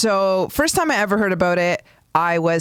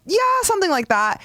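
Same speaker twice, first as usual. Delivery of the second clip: mock mom voice; 12 semitones higher, faster than usual. Mom dodging the question.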